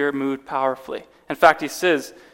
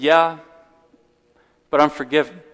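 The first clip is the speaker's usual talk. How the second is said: ordinary talk, nothing marked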